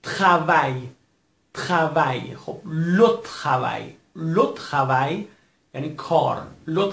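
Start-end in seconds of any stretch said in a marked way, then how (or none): 0.0-2.3 تخوای with a raspy KH for the French R. Shown as a demonstration. 2.7-5.2 لو تخوای slowly, the R as KH. Shown as a demonstration.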